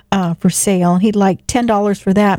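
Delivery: normal talking voice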